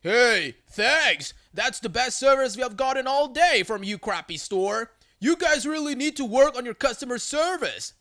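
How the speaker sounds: lightly acted angry customer yell. A narrator's reading. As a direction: call out a sarcastic complaint. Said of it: "the guy yells out".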